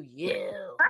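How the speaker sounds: low, dropped YOU; mocking put-down. Coach imitating client's harsh inner voice, low and scornful. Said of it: "how you went from".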